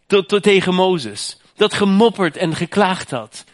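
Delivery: loud, high, slow emphatic preaching; fervent preaching. Real, not acted. Preacher exults to the congregation that Israel is blessed, loud. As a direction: drive it home to the church.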